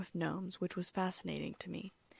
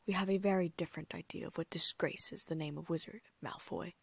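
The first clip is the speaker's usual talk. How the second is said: ordinary delivery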